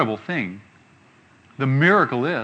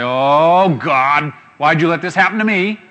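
mildly louder whining complaint to God; grumbling complainer; 2 semitones higher, 6 dB louder than usual. Retold or imitated. Preacher imitates people grumbling at God over hardship. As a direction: lightly act out someone moaning at God. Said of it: "They say".

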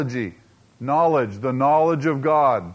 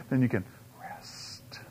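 a slow hushed whisper of relief; soft whisper; 6 semitones lower, 12 dB quieter than usual. Real, not acted. Pastor assuring listeners they can rest, slow hushed whisper. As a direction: breathe it out gently, letting go. Preacher soothing the congregation.

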